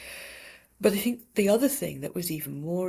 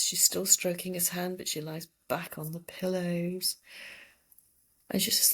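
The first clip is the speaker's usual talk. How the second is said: usual speaking voice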